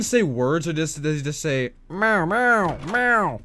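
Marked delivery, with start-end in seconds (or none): 1.9-3.4 higher mewing cartoon noises; Shy Guy. Streamer guessing at a Shy Guy voice, high mewing. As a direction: mew it in a high little voice. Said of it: "Can you do a Shy Guy voice?".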